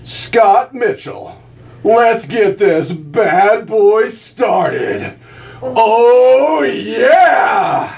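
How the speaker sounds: hammed-up shouting radio promo; loud hype announcer. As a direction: roar it like a monster-truck announcer. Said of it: "I got a loud voice here".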